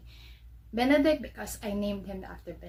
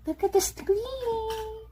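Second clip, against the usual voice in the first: much higher, slow pleading pet voice; 12 semitones higher, slower than usual. Real, not acted. Owner pleading with her dog to look at the screen, higher baby talk.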